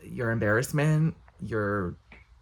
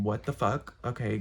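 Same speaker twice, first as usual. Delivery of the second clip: normal talking voice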